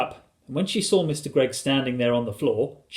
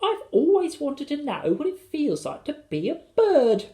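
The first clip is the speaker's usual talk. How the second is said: put-on high-pitched, thrilled little boy; high, gleeful storybook child; William; 14 semitones higher than usual. A narrator's reading. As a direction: gush it in a child's voice. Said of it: "cried William".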